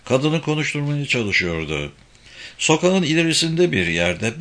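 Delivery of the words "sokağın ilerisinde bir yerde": plain reading voice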